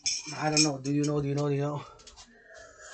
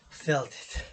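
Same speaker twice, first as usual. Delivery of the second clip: usual speaking voice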